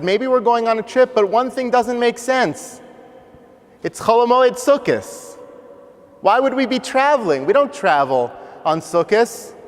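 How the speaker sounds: put-on high-pitched, bewildered child; puzzled young boy. Eight-year-old boy wondering why they'd travel, puzzled. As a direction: wonder it aloud like a confused little boy.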